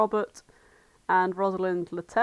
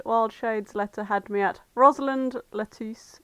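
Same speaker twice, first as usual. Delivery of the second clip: ordinary delivery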